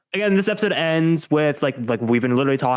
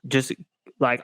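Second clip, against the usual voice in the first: ordinary delivery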